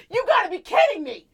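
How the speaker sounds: high, loud disbelieving yell; retold loud snap of disbelief; exasperated outburst. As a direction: blow up in disbelief. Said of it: "that's when I lost it".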